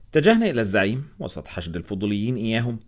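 ordinary delivery, nothing marked

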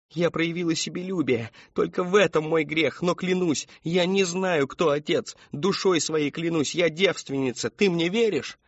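high-pitched, anguished confession; tearful plea. A narrator's reading. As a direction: pour it out to your mother in a high voice. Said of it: "Сквозь слёзы она смотрела на мать".

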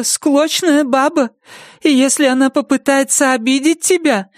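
high, thin, protective; Nettie to her dog. Acted. Nettie comforting her dog Bandit, high protective voice. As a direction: promise the dog she'll protect him.

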